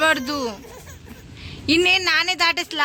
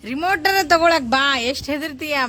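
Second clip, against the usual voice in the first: normal talking voice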